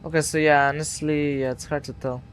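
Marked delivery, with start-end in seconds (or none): none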